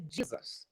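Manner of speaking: "Jesus" said the English way, "JEE-zus"; "Jesus" as in English, first syllable "jee"; English pronunciation. Shown as a demonstration. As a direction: open "Jesus" with an English DJ sound. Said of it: "no inglês é".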